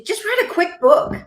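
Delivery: high, airy, casual push; quoting the agent, pitched high and light; breezy agent. Retold or imitated. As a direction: make it sound easy. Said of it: "So she".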